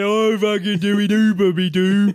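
loud, high hammed-up creep; silly creepy voice. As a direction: play the leering creep. Voicing reply in skit.

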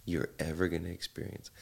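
ordinary talk

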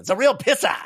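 put-on high, loud Boston accent; Ben Affleck Boston accent. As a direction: say it like a Boston guy. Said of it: "Ben Affleck out there with his Boston accent".

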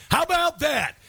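high-pitched, punchy comeback; indignant retort. Pretend. Host heckling the journalist's clip, high indignant retort. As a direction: throw it back at him, pitch jumping up.

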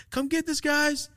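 high call across the room; imitated dad calling his kids. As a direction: call the kids over to the door. Customer calling the kids over.

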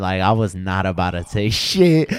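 climbs high and loud, defensive; rising loud defense. Retold or imitated. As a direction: build to a loud, pleading finish. Defending himself to girlfriend, retold.

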